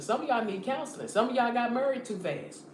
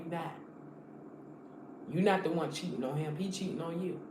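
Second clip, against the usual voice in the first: soft comforting; 5 semitones lower, 6 dB quieter than usual. Acted. Comforting a betrayed wife.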